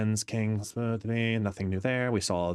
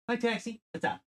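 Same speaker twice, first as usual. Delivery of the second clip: high dog-greeting voice; 12 semitones higher, 4 dB quieter than usual. Real, not acted.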